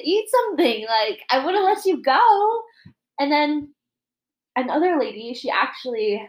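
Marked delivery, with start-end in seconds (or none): 0.0-2.6 retold protest, higher and louder; indignant retort. Retelling her complaint to the man who left, indignant. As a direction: throw it back at him, mock-indignant.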